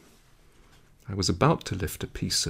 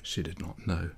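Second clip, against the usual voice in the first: ordinary delivery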